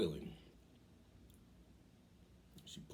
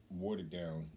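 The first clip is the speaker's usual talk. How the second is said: usual speaking voice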